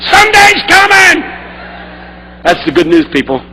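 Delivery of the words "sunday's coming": high, loud, slow call-back; crowd yelling back. Retold or imitated. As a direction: shout it back like a whole congregation. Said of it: "congregation yelled back".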